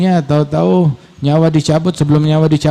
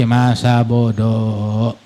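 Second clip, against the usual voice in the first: low, very drawn-out shrug of a phrase; couldn't-care-less; 5 semitones lower, much slower than usual. Acted. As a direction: drag it out dismissively. Dismissive quote, drawn out and low.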